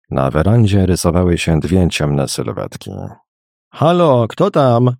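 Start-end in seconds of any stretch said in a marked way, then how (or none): 3.7-4.9 wyżej podniesiony głos, pytające zawołanie; wołanie. A narrator's reading. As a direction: call out a question, pitched high. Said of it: "zawołał jeden z osobników". (wołanie podniesionym głosem)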